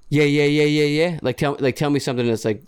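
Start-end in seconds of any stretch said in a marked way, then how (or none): 0.1-1.2 dismissive yeah-yeah. Retold or imitated. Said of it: "very annoying when I hear people say".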